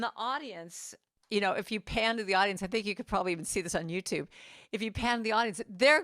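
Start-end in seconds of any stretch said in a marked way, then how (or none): none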